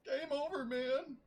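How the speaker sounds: high, hammed-up panicked marine cry; mock movie panic. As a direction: toss it off like a doomed soldier in a film.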